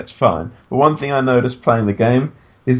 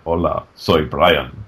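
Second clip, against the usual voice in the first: lower, slow macho Brian; manly Spanish voice; 6 semitones lower, slower than usual. Retold or imitated. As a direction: say it like a suave Spanish hero. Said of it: "a guy with glasses who walks around like".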